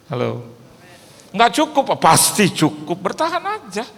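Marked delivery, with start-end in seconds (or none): 1.3-3.9 louder, rising call to endure; preaching exhortation. Acted. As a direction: urge the congregation to hold on. (berseru menguatkan jemaat)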